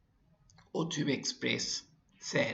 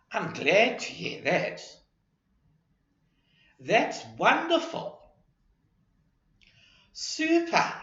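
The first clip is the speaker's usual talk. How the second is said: rising, delighted and bright-toned praise; happy; 8 semitones higher, 3 dB louder than usual. Shown as a demonstration. Teacher showing happy tone of voice to students. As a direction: sound pleased for someone. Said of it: "If we express happiness, our voices change".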